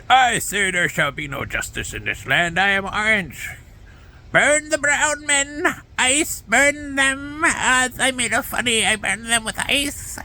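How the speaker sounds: high, slow put-on Trump voice; Trump impression. Mocking Trump to viewers, high and slow. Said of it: "That's my best impersonation of Trump".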